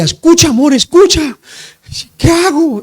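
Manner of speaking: imitating a panicked, crying plea; retold tearful cries. Terrified wife calling her husband by phone, imitated crying plea. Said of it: "Y entonces llorando y gritaba".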